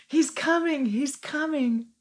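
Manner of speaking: high, joyful imitated child's cry; excited crying out. Repeating little Hadassah's words after laughing, excited crying out. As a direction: call it out high, stretching the last one. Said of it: "little Hadassah is crying out".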